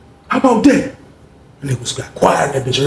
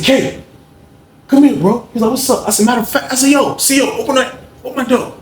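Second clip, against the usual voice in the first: hoog roepend door de gang; calling out; 11 semitones higher than usual. Retold or imitated. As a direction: roep naar de bewaker verderop. Calling out to guard.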